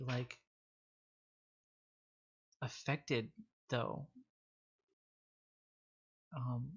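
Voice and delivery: softly voiced, hesitant companion; tentative Jaina in character. Jaina tells Doc what she sensed, hesitant and soft. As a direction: speak softly, with halting pauses.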